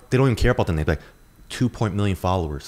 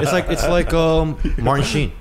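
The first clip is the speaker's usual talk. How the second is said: excited; 7 semitones higher, 5 dB louder than usual. Real, not acted. Eager realization.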